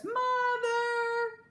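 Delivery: put-on high, loud child's wail for mother; calling out. Girl shouts for her mother after the crash, high loud wail. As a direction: yell for Mother across the house.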